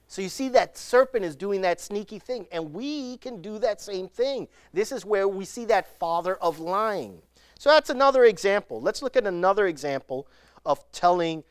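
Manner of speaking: usual speaking voice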